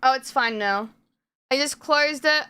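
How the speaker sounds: ordinary talk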